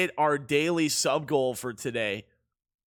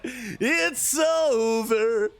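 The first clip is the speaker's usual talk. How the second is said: drawn-out wail of defeat; mock tragic cry, very slow; mock despair; 4 semitones higher, much slower than usual. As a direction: stretch it out slowly, hammed-up doom. Lost all savings, mock despair.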